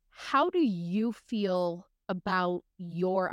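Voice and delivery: ordinary talk, nothing marked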